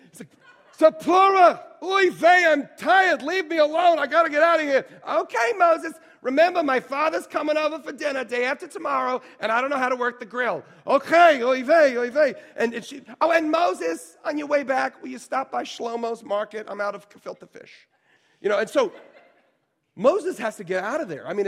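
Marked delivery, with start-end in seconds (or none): 0.8-4.9 loud, high, weary Yiddish kvetch; Yiddish accent. Acted. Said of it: "I'll do Moses". 5.1-10.6 high, loud nagging Yiddish wife; Yiddish accent. Acted. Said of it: "if your wife talked like that". 10.9-12.3 loud, high, resigned Yiddish groan; Yiddish accent. Acted. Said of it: "I'll do Moses". 13.2-17.9 high Yiddish wife, fading down; sing-song Yiddish accent request. Acted. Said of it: "if your wife talked like that".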